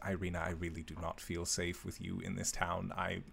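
low, soft warning to a friend; hushed tones. Acted. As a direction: keep it down, speak softly. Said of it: "talking very, very in hushed tones".

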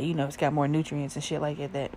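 ordinary talk, nothing marked

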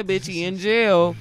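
drawn-out mock retort to the girlfriend; slow, sassy reply. Voicing the girl who stopped texting, sassy and slow. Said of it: "Well, yeah".

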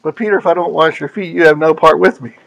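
low, soft, gentle reply; gentle Jesus. Retold or imitated. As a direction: answer Peter tenderly. Jesus answering Peter gently.

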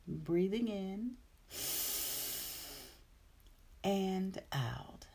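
guided meditation. Acted. Breathing cue in meditation.

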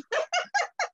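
a high burst of laughter; real amused laugh. Real, not acted. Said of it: "You are so correct".

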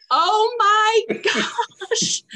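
laugh-crying. Real, not acted. Laughing while retelling.

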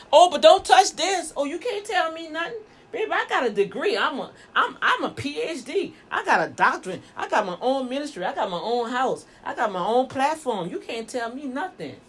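mock know-it-all with swooping pitch; boastful, defiant. Preacher mimicking someone too proud to be taught, boastful. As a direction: rattle off credentials with haughty swagger. Acting proud, unteachable degree holder.

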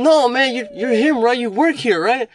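high, loud puzzled customer question; customer's voice. Retold or imitated. Confused customer asking if he works there, high and loud.

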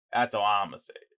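ordinary talk

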